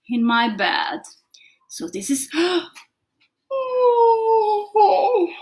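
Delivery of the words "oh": mock yawn. Shown as a demonstration. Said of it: "very sleepy".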